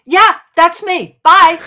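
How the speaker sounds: loud, high, punched words; big, drawn-out mock farewell; breezy exit. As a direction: toss it out loudly as you make your escape. Her brisk exit line, retold.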